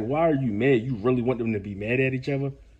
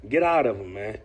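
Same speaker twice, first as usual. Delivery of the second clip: usual speaking voice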